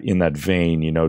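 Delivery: usual speaking voice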